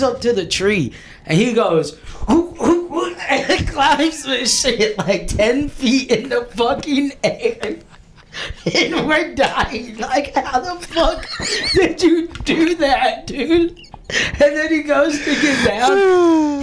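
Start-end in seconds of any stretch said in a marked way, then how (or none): 2.3-3.1 high, imitated ape hoots; monkey hoots. Mimicking drunk Andy hooting up the tree. 3.3-16.6 high, slow, breaking into laughter; can barely get the words out for laughing; laughing while telling. Real, not acted. Retelling Andy's climb, cracking up with real laughter.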